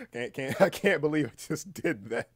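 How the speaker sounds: words through real laughter; can barely get it out for laughing; giggling disbelief. Real, not acted. Laughing at own ad bit.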